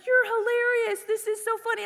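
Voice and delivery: high, lightly imitated amused boyfriend. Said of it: "And Derek's laughing".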